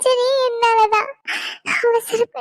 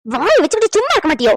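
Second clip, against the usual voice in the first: sharp rebuke; 3 semitones lower, 4 dB louder, faster than usual. Acted. Husband snaps at mother, asks daughter-in-law.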